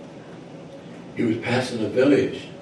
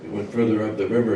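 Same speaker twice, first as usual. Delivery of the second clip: ordinary delivery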